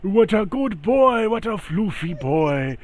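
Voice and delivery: doting cat lover. Acted. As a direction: coo at the panther like a baby. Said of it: "and he's like".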